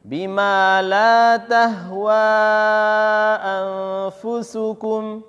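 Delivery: very slow chanted verse; slow recitation. Shown as a demonstration. Teacher models the phrase slowly for students to follow. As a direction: draw out each word for the class to repeat.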